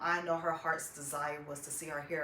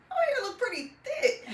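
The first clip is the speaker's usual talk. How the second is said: high, loud retold amazement; excited reaction; 6 dB louder, faster than usual. Daughter seeing her mom's hair in the bathroom, excited. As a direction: relive the surprise, pitch way up.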